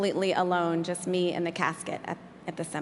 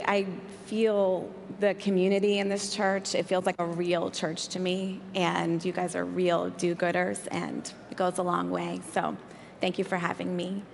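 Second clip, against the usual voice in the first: ordinary talk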